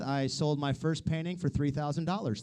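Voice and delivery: usual speaking voice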